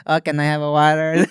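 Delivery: a hint of the actor's funny voice; light mimicry of a man asking, laughing; lightly imitated actor. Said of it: "dude just sounds like that".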